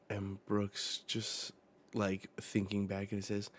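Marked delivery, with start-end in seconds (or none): none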